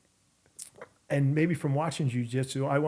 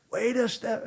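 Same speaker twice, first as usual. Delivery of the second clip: much higher-pitched elated mimicry; happy cheering; 10 semitones higher, slower than usual. Storyteller repeating the happy opponent's line for laughs, happy cheering. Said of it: "happy that I fought him".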